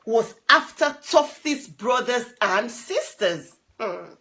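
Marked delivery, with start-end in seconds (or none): none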